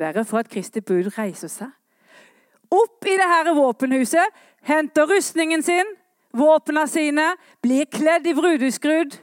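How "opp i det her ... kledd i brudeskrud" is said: loud, high, rousing call from the pulpit; fiery revival preacher; full-force preaching. Acted. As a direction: proclaim it to the whole church, ringing out.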